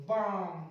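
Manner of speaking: बाम with long "aa" straight into M; silent L. Shown as a demonstration. Teacher giving another silent L example, बाम said "baam" without L. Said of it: "ऐसे ही".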